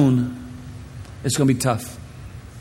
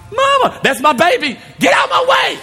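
frantic mama shout. Retold or imitated. Mother at the playland slide, shouting frantically for her baby. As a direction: push through the crowd yelling for the baby.

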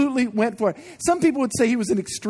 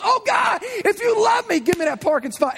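mock pleading prayer; 10 semitones higher than usual. Mock prayer for parking spot.

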